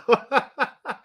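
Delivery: high-pitched laugh after getting choked up; embarrassed emotional laugh. Real, not acted.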